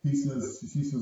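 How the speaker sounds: usual speaking voice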